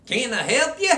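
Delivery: high, loud little-man voice; little salesman. Acted. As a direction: chirp it up like a tiny eager clerk. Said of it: "this little guy about five foot tall".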